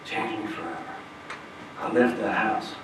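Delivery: usual speaking voice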